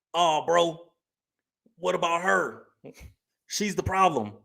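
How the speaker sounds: lightly mocking a guy blaming her; a hint of a whiny dude deflecting blame; whiny excuse voice. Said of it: "they gonna be like".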